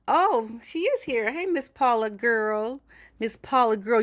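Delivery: light up as she spots her friend; excited greeting. Real, not acted. Greets viewer Paula arriving in chat.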